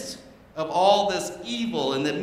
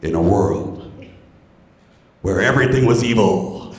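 deep, booming trailer narrator; movie trailer voice; 8 semitones lower, 11 dB louder, slower than usual. Pretend. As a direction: growl it out like an epic trailer. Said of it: "Sounds like the beginning of a cool movie trailer".

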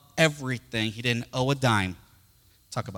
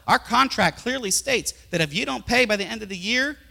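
loud acted refusal, rising higher; angry demanding creditor; 7 semitones higher, 6 dB louder than usual. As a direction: insist forcefully on being paid. Creditor refuses, demands money now.

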